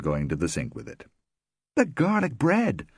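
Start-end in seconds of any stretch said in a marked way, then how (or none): 1.8-2.9 higher, slower startled exclamation; suddenly remember the oven; sudden remembering cry. Acted. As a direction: hostess in a flap, pitched up. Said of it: "Rosemary cried".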